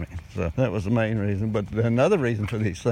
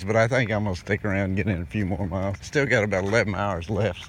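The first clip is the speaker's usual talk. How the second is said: usual speaking voice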